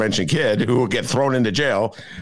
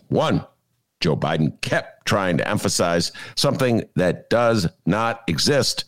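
usual speaking voice

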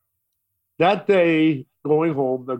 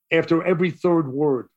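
ordinary delivery, nothing marked

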